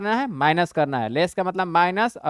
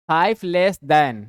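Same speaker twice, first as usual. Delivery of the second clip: "five less than" drawn out, a bit louder; slow reading of phrase; 5 dB louder, much slower than usual. Shown as a demonstration. Teacher reading the problem aloud to students, slow. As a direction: stretch each word of "five less than". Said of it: "reads the phrase aloud".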